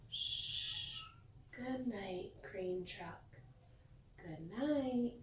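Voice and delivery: soft whispered shh and goodnight; tucking in a sleepy truck; hushed goodnight. Pretend. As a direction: whisper it as if a child just fell asleep. Bedtime goodnight to toy truck.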